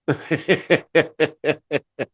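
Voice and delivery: big high burst of laughter; loud laugh. Real, not acted. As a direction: laugh out loud at your own act. Laughs after own demo.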